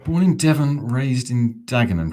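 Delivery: West Country sliding into Essex; broad Devon burr into Dagenham; Devon into Dagenham accent. Pretend. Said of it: "Try that accent".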